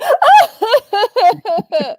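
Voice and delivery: loud, high mock cackle; big put-on laughing fit; imitated outburst. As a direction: burst into an exaggerated high-pitched laugh, imitating someone. Imitating Alexia's reaction to the excuses.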